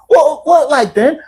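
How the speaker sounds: high English mock questioning; imitated English accent. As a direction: ask it in a put-on English accent. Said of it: "I love your accent".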